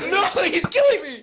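laughing. Real, not acted. Laughing while dying.

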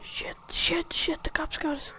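hushed high panic; panicked whisper. Real, not acted. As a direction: whisper your panic, cops close. Cops arrive, hushed panic.

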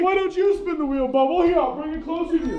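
ordinary delivery

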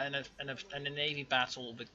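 ordinary talk

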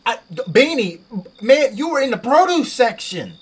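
high, loud disbelief; baffled outburst at the cashier; incredulous. Real, not acted. Confused outburst at game customer.

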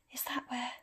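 soft, breathy half-whisper. Acted. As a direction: hush it, barely voiced. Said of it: "whispered Professor McGonagall".

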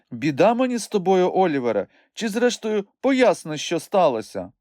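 high-pitched, impatient woman; exasperated demand. A narrator's reading.

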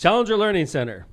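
loud, high sponsor shout; mock-grand announcer blast; booming announcer. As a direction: belt out the sponsor name. Booms name before sexy voice.

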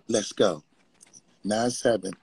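lightly imitated hype-man interjections; short background ad-libs, mildly mocking. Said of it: "Try to mock [name] and shit".

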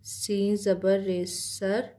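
very slow spelling of सीन ज़बर रे सर; spelling out letters. Shown as a demonstration. Teacher spells सीन ज़बर रे सर for students, very slowly. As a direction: drag each letter out slowly. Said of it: "किस तरह से pronounce करते हैं".

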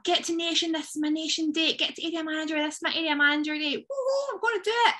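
mock over-eager, high-pitched hype; excited goal-setting. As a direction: build to a squealed, gung-ho cheer. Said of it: "So we get excited, we set big goals".

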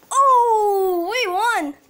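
loud, high whoop of victory; victory cry. Real, not acted. Celebrates winning the match.

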